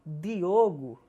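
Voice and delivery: Diogo with a hard plain d, slow; northeastern Brazilian. Shown as a demonstration. Teacher imitates northeastern Brazilian saying Diogo. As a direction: say Diogo with a clean hard D like a nordestino. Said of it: "como se fôssemos nordestinos".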